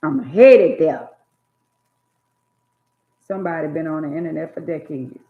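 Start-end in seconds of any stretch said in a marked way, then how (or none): 0.0-1.1 slow, much louder preached repeat; emphatic preacher's repeat; slow loud refrain. Real, not acted. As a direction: drive the line home slowly. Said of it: "repeated refrain, slow loud take".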